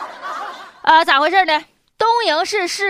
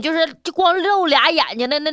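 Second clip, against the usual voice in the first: normal talking voice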